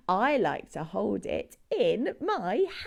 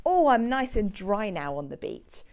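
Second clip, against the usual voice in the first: ordinary talk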